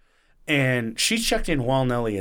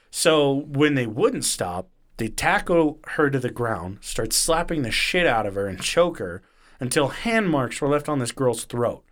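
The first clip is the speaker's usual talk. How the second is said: normal talking voice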